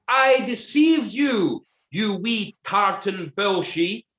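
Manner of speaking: very high, slow, loud gloating; villainous exclamation. Acted. Duke reveals himself to Gordon, slow high gloating. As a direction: drag out every word with evil glee. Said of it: "exclaimed the perverse pretender".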